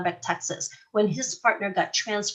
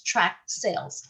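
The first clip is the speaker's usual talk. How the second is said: slow, drawn out; much slower than usual. Shown as a demonstration. Teacher introducing a new term to class, slow and drawn out. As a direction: draw out tract sales slowly like a new term. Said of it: "for those of you who do not know".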